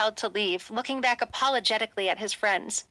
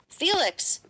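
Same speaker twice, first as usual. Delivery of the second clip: calling out; 7 semitones higher than usual. A narrator's reading. Brother calling after Felix as he storms off, calling out. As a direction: call after him across the lot. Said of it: "Alex called".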